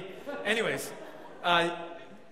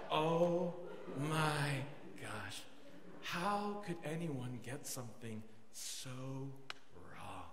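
slow, sinking low and very soft; mock crushed realization; 7 semitones lower, 9 dB quieter, slower than usual. Preacher realizing he misread her, quietly crushed. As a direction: let each word drop further in dismay. Heart sank after being turned down.

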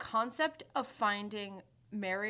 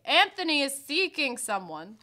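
loud announcement; 6 semitones higher, 8 dB louder than usual. Retold or imitated. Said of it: "loudly announcing to the room".

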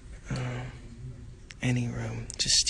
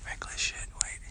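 whispering; 9 dB quieter than usual. Real, not acted. Hiding, hushed.

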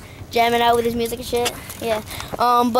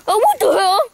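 higher hammed-up Aussie whine; mock distressed wail; 9 semitones higher, 4 dB louder than usual. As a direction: wail in disbelief at the disaster. Host wails in acted distress.

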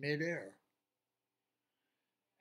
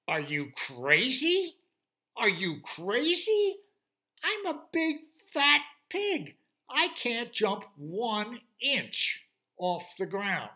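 slow, very loud, high pig protest; incredulous pig; 9 semitones higher, 11 dB louder, slower than usual. Acted. Fat pig telling the unicorn he's crazy, loud and high. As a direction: balk at it in disbelief.

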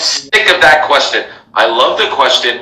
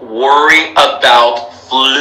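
FLUENCY stretched out very long for emphasis; slow down and hold the first vowel of "fluency"; drawn-out emphasis; 2 semitones lower than usual. Real, not acted. Drives home the main point.

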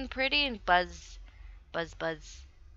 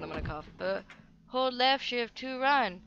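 ordinary talk, nothing marked

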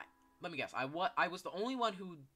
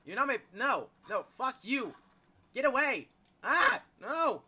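loud, high yelling; panicked defiance; 9 semitones higher, 10 dB louder, slower than usual. Real, not acted. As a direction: fend off the monster with yells. Fending off the chasing monster.

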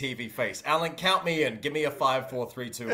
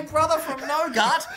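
ordinary delivery

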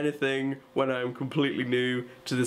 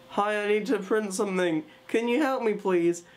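retold plea in a higher voice; nervous polite request; 7 semitones higher than usual. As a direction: ask politely, pitched up and timid. Nervous newcomer asks for help.

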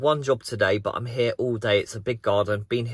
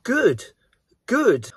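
higher, louder gloating good; smug satisfaction; 11 semitones higher, 4 dB louder than usual. Retold or imitated. Vlogger recalls being pleased the rude driver got scared, gloating. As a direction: relish it. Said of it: "And I thought".

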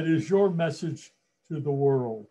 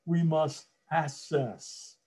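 normal talking voice

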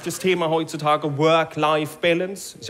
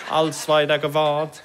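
ordinary delivery

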